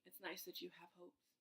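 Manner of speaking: soft, deadpan sarcastic thought; dry inner voice. Retold or imitated. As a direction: murmur it like a private eye-roll. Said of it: "in my mind, I'm like".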